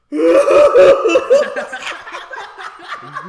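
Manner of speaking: very loud, high wail breaking up; mock bawling wail. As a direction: burst into exaggerated bawling. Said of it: "I start fucking bawling".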